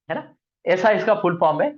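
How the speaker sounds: ordinary talk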